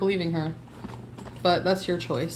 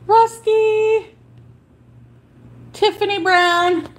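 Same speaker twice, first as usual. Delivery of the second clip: much higher, louder call; calling out names; 9 semitones higher, 4 dB louder, slower than usual. Real, not acted. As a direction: shout the names across the room. Calling out names while modding.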